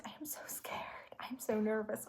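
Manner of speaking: nervous whisper. Real, not acted. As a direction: breathe the words out, scared of the ending.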